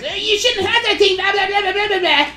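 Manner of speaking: high-pitched mock nagging mom voice; mocking angry mom. Imitating the kid's furious mom scolding him, high mock whine. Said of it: "all this stuff".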